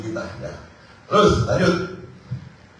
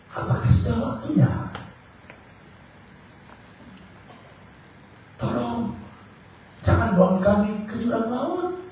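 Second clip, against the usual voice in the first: a cornered demon begging the Lord; demon pleading; 12 semitones higher than usual. Acted. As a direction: demon crying out to Jesus, high and pleading. Langsung si setan itu ngomong.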